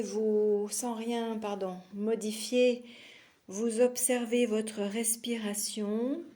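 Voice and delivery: normal talking voice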